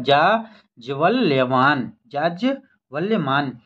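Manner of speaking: जाज्वल्यमान said very slowly, syllable by syllable; slow spelled-out word. Shown as a demonstration. Teacher slowly sounding out जाज्वल्यमान for the class. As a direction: draw out जाज्वल्यमान slowly for students. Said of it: "ये शब्द देख लीजिएगा".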